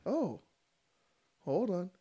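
usual speaking voice

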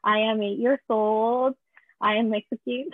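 put-on slow, halting English with a thick Spanish accent; careful newcomer English, heavily Spanish-accented; beginner's Spanish-accented English. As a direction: imitate a child's rehearsed English in a Spanish accent. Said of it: "Y yo con lo poquito inglés".

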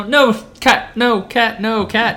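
loud, high, sharp no; scolding the cat. Real, not acted. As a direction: shoo the cat away.

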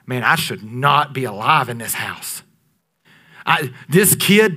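ordinary talk, nothing marked

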